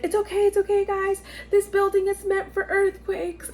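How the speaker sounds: very high-pitched, frantic reassurance; squeaky mock-calming voice; imitated reassuring nurse. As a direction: play a rattled nurse soothing the room. Nurse reassuring everyone during quake.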